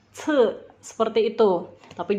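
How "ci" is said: "C" as ts with a puff, tongue behind teeth; aspirated dental C. Shown as a demonstration. Teacher showing C with air, aspirated. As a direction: spit air lightly through "C". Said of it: "itu keluar angin".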